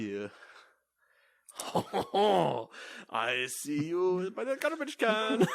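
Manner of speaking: slow, laughing cartoon French accent; hammed-up French skunk suitor. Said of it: "the only French thing I can do is a really offensive".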